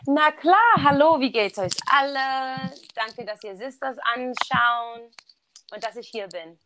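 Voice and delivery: says a German greeting and thanks on request; speaking German. Shown as a demonstration. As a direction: speaks German naturally, a little slow. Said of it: "can we ask you to say something in German for us".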